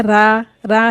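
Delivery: रा with a light open aa; Hindi-style ra. Shown as a demonstration. Teacher shows how not to read it, thin Hindi रा. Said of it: "हिंदी वाला रा नहीं".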